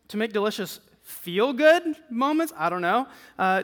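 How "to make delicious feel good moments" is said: questioning emphasis on "feel-good"; doubtful stress on FEEL-GOOD. Shown as a demonstration. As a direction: draw out "feel-good" as if unsure. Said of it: "depending on where you put the emphasis".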